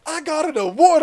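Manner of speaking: high, loud proud announcement; imitated high-pitched excitement; super stoked kid. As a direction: burst out like a thrilled kid. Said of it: "he was super stoked".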